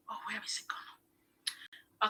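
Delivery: hushed, slow whisper to herself; whispering to herself while hunting for the image; whispered aside. Real, not acted. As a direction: breathe it out while searching. Searching screen, muttering aside.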